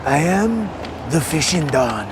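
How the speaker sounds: mock mafia-boss voice; Italian mobster. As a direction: proclaim yourself as a mob don. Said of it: "do it in that voice".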